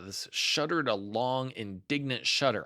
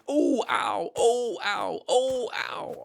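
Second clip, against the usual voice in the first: high, loud oh-ow yelps, rising higher; bouncing yelps; 12 semitones higher, 4 dB louder, much slower than usual. Acted. Piglet bounced along all the way home, rising yelps. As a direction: yelp with every bump. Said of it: "he was saying".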